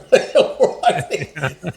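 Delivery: loud cackling witch laugh; witch cackle. Shown as a demonstration. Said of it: "That sounds more like a witch".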